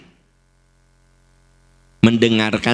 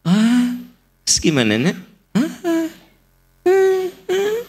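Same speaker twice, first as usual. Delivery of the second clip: eager, rising listener's ahs, much higher; empathic parent listening. Shown as a demonstration. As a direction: play the attentive mother nodding along to her child. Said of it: "Jadi Anda teh itu empatik". (ibu yang mendengarkan anak dengan penuh empati, nada tinggi)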